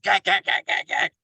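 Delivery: shouted high gak gak choking noises; fake-gag loudly for a laugh; mock gagging. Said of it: "Robbie's like".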